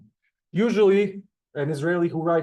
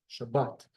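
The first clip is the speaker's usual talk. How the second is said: Shabbat with the last syllable stressed; Shabbat, weight on the end; stress on bat; 3 semitones lower, 5 dB quieter than usual. Shown as a demonstration. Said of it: "the emphasis is on the bet".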